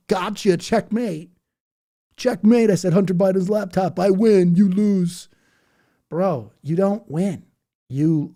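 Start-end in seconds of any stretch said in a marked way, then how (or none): 0.1-5.3 loud, high mocking gloat; smug gotcha gloat. Mocking online trolls with a loud, high smug gloat. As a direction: crow it like a troll who thinks he won. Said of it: "And they think".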